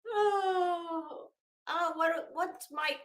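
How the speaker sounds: high, delighted gasp of surprise; surprised. Real, not acted. Streamer spotting Mike's turtle in chat, high and surprised. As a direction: caught off guard by something cute.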